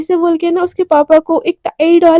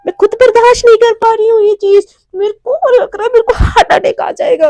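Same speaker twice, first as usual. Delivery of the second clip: high, overwhelmed whimpering complaint; mock tearful; 6 semitones higher, 3 dB louder than usual. Mishka overwhelmed by her new wealth, acted tearful. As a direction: wail lightly as if it's all too much. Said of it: "तू है कि रो रही है".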